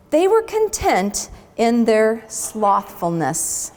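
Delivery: plain reading voice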